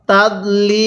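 "তাদলিল" with a long level "lee", closing on l; stopped waqf form. Shown as a demonstration. Teacher shows stopping rule, "তাদলিল" held then closed on l. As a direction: hold "তাদলিল" long and drop the "in" ending. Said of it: "লামের উপর যদি সাকিন দেই কিভাবে পড়ব".

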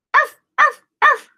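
high yapping arfs; high, loud puppy yaps; dog barking. Acted. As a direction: yap like a small dog.

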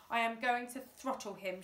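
plain reading voice

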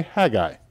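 Haggai as HAG-eye, two syllables; northern pronunciation. Shown as a demonstration. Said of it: "if you go more to the west or to the north".

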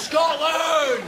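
very high defiant yell; defiant war cry. Acted. Trapped passenger defying the lift, war cry. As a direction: scream it like a battle cry.

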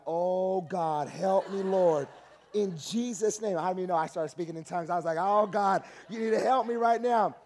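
panicked prayer. Retold or imitated. Panicked in church, loud desperate prayer. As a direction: cry out to God for rescue.

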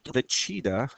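normal talking voice

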